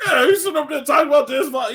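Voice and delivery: hammed-up friend getting heated; imitating Cole Jackson. Cole Jackson scolding the host over his music take, hammed-up and fast. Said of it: "And he's like".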